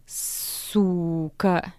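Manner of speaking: a groaning insult; drawn-out curse. Acted. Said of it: "тянет он".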